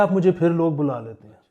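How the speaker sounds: normal talking voice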